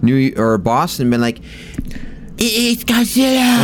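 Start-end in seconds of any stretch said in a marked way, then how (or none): 1.4-3.6 high, drawn-out comic cry; slow, goofy pitched-up exclamation; Adam Sandler. Acted. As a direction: cry it out slowly in a silly high voice. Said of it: "I'm gonna do my Adam Sandler voice".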